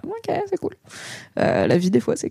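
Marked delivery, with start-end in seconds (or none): 0.1-0.8 breezy. Retold or imitated. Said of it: "et je suis là".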